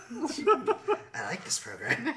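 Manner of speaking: slow, laughing captain impression; Janeway impression. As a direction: drawl it out slowly, laughing. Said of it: "That's a good impression".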